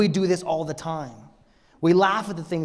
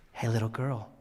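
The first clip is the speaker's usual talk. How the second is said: fatherly, tender; 5 dB quieter than usual. Acted. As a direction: wake a child softly, as a caring father. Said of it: "of a father caring for a child".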